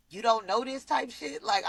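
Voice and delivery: ordinary delivery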